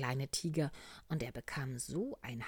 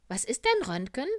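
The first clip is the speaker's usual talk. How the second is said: high, loud, curious question; little bear asking; 10 semitones higher, 11 dB louder, faster than usual. Acted. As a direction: pipe the question up with a rising end. (hohe, neugierige Bärchenfrage)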